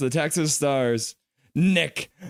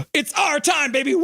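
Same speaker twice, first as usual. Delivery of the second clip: excited cheer; 9 semitones higher than usual. Real, not acted. Celebrating his team's win.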